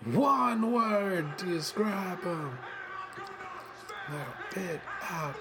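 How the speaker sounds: slow, hushed spelling-out; Enzo shtick. Retold or imitated. Imitating Enzo's catchphrase, slow and hushed. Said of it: "we're back to the shtick".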